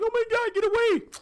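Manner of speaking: very high, loud frantic yell; mock panic. Priest swinging her staff at goblins, acted panic. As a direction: shriek it, hammed-up.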